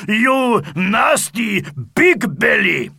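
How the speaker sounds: loud, slow angry Viking shout. A narrator's reading. Sigurd shouting at Monster Mash to defend his wife, loud. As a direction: shout the insult at the bully. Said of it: "he cried".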